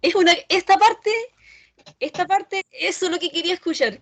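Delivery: más alto y fuerte, celebrando la respuesta; entusiasmado. Real, not acted. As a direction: exclama contento que dieron en el clavo. (emocionado al oír la palabra clave)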